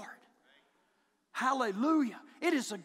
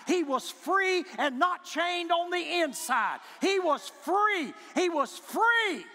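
loud slow cry of freedom; preaching climax; 5 semitones higher, 4 dB louder, slower than usual. Acted. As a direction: hammer each "free" slowly and loud.

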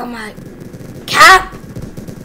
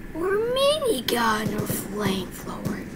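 whispered weapon options; 5 semitones lower, 15 dB quieter than usual. Real, not acted. Gamer quietly weighing which gun to pick. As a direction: murmur the choices to yourself.

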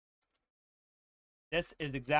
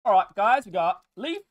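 loud, high hype call; announcer voice; 5 semitones higher, 9 dB louder than usual. Pretend. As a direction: hype it up like a host. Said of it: "a different voice for every pack".

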